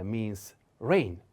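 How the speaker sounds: normal talking voice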